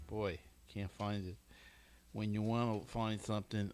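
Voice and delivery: ordinary talk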